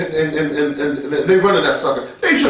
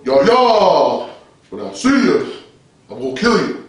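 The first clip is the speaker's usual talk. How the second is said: mock solemn threat, falling in pitch; affected deep holy voice; slower than usual. Mocking a believer threatening someone, affected deep voice. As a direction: intone it with exaggerated pious gravity. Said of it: "don't gotta say it like that".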